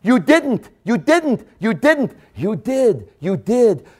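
high, loud insistent "You didn't"; emphatic repetition. Acted. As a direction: drive it home each time. Preacher hammers repeated phrase.

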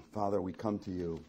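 low, hushed prayer with long pauses. Real, not acted. Preacher leading the congregation to the Father, hushed prayer. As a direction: pray softly, phrase by phrase.

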